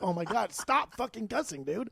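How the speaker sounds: exasperated. Retold or imitated.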